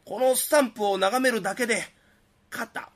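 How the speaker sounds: hyped TV shopping pitch; 通販CMの売り口上. Acted. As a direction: sell it like a late-night infomercial. (テレビ通販の売り込み口調)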